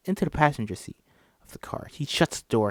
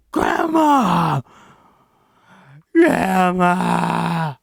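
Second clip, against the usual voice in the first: anguished cry; 5 semitones higher, 7 dB louder, much slower than usual. Acted. Jeremy crying over Georgina.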